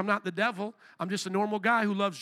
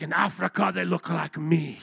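put-on African accent; 4 semitones lower than usual. Preacher retelling a Kenyan brother's answer, imitated African accent. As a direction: attempt an African accent, pitched down. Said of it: "trying to do my African accent".

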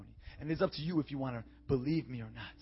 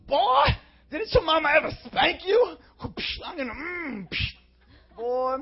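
much higher, much louder scolding mother; hammed-up angry mama yelling at a disrespectful boy; 14 dB louder, slower than usual. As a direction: yell like an old-school mama reaching for the belt. Old-school parent with belt.

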